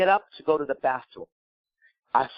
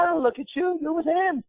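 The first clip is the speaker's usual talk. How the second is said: defensive; 11 semitones higher than usual. Retold or imitated. Defending himself to her.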